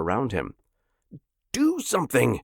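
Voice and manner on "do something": screamed. A narrator's reading. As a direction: scream at them to act. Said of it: "he screamed".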